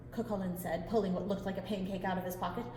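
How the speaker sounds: ordinary delivery, nothing marked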